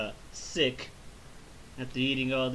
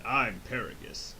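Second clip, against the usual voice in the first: shady Paragus; 4 semitones lower, 6 dB quieter than usual. Retold or imitated. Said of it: "this dude Paragus is like".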